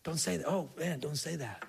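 soft, hushed shushing; hushed warning. Retold or imitated. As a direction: quietly warn each other to stop. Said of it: "they just".

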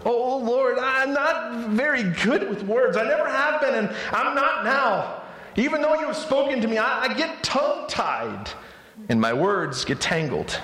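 high, pleading whine; whiny voice. Acted. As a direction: beg like a reluctant hero. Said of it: "I want to give him a whiny voice".

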